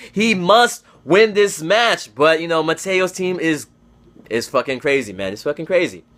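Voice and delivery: ordinary talk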